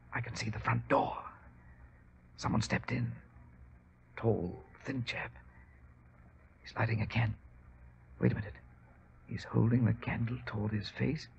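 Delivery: softly describing what he sees; hushed report. Acted. Watson whispering to Holmes behind the clothes horse, hushed. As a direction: keep it down, you're hiding. Hiding, watching the intruder.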